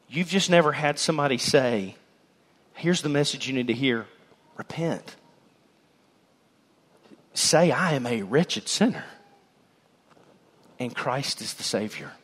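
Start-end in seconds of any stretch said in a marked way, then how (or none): 2.7-12.1 softer and lower, gently pleading; tender appeal. Real, not acted. Preacher pleading with the room to repent, soft and tender. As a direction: invite them gently to repent.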